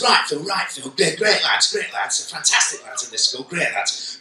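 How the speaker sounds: a lightly done Mancunian teacher voice; hearty Mancunian assistant head, a touch put on; Mancunian accent. Assistant head greeting the visiting speaker, in Mancunian accent. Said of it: "this is me doing a Mancunian accent".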